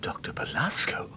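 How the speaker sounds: hushed, caught off guard by the name; a stunned whisper as the name lands; shocked whisper. Acted. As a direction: breathe the name in a startled whisper. Startled at the feared name.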